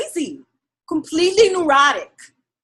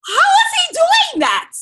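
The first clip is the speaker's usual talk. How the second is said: high, loud amazed cry of disbelief; amazed shout; 6 dB louder than usual. Retold or imitated. Marvelling out loud at the actor's Dominican role. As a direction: shout it in awe at his skill.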